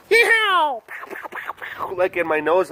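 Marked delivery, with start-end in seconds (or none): 0.1-0.8 much higher, louder hammed-up cowboy yell; voicing little Texas pollens; cowboy yeehaw. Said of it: "they're just like". 0.9-1.9 whispered pow sound effects; mock gunshots. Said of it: "they're just like".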